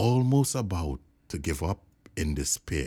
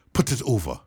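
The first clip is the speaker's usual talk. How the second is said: ordinary delivery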